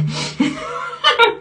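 high squealing laugh. Real, not acted. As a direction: crack up at your own joke, high-pitched. Laughs at her own crude joke. (carcajada aguda)

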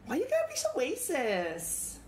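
high, whiny put-on complaint; mock offended voice. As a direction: play it as fake outrage. Mock-offended banter on stream.

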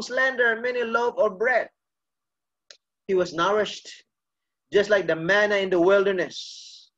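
ordinary talk, nothing marked

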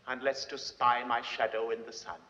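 ordinary delivery

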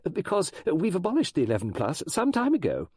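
high, insistent schoolteacher; firm on accuracy. A narrator's reading. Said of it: "was always firm on accuracy".

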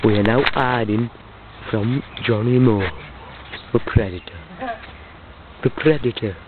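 low, soft wildlife-documentary hush; hushed commentary. Pretend. Cameraman hiding from a predator, hushed commentary. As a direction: keep your voice right down, as if hiding.

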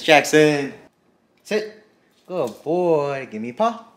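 firm loud call of his name, then a high command; calling the dog. Real, not acted. Owner commanding her dog to sit, firm call.

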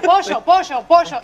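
mother coaxing with Dominican pollo /poʃo/. Retold or imitated. Mother coaxing her son, saying pollo the Dominican way. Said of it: "y yo le decía".

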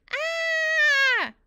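very loud, high scream. A narrator's reading. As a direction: let out a long piercing scream. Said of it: "I screamed".

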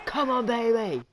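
high, shouted challenge; loudly imitated wrestler's catchphrase; Chris Jericho. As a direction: call it out as a wrestler's taunt. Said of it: "Chris Jericho saying".

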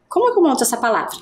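ordinary talk

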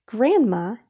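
plain reading voice

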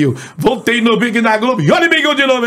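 high-pitched sports announcer impression; José Carlos Araújo. Imitator giving a snippet of a sports announcer, high-pitched impression. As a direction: call it like a radio football commentator, pitched high. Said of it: "o José Carlos Araújo".

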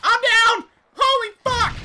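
high, loud cry of alarm; panicked shout. Real, not acted. Player tells team they're down, panicked shout. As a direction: yell it to teammates in a panic.

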